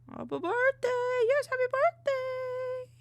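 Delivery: loud high birthday cheer; cheering happy birthday. Real, not acted.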